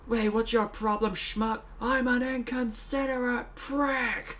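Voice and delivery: high-pitched put-on film quote; movie character's outburst. Quoting an insulting comedy film line, high put-on voice. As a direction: push the voice high, dragging the last words. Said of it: "stuff that he comes out with".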